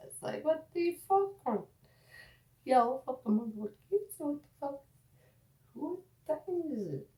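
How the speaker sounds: high, incredulous ranting; exasperated. Real, not acted. Exasperated rant about kid show.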